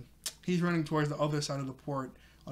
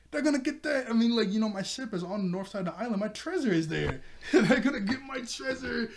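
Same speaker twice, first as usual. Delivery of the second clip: mock panic; 7 semitones higher than usual. Nami panics that pirates will take her treasure, high mock wail. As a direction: wail about the treasure, half laughing. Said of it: "she starts freaking out".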